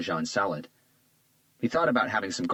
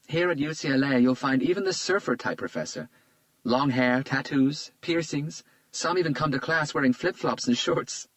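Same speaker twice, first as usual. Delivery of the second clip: put-on lightly raised, charmed young woman; a flattered young woman, a bit higher; Isabella's voice; 8 semitones higher, 3 dB louder than usual. A narrator's reading. Isabella charmed by Hunter's compliment, lightly higher voice.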